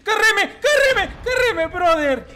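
very high, loud screaming chant; hyped shouting. Real, not acted. Gamer screaming at teammate after a win, hyped. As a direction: scream it out, last one slowed.

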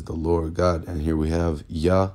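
ordinary talk

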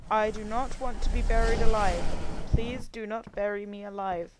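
loud desperate plea; loud pleading. Real, not acted. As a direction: beg them not to bury you. Pleading in the coffin.

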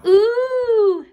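loud, high drawn-out groan; long, loud "ooh" of dismay; audience groan. A narrator's reading. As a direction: groan like a disappointed crowd. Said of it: "the audience groaned".

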